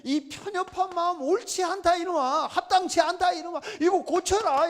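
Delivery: high-pitched, pleading rebuke in God's role; acted exasperated divine reprimand; God scolding. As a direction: scold a stubborn servant, imploring him to change. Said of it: "이거 하나님께서".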